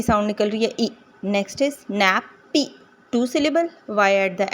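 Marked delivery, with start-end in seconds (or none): 1.9-2.8 नैप्पी broken into नैप, पी; split syllables. Shown as a demonstration. Teacher reading nappy as a two-syllable example, split syllables. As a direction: say नैप, pause, पी. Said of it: "syllables को अलग-अलग करने के लिए".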